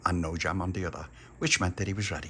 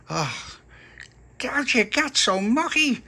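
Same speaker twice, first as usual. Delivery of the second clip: high, slow, put-upon mum voice; exasperated mum; 5 dB louder, slower than usual. Acted. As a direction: sigh it out like a fed-up mother. Mum complains, scrubbing face.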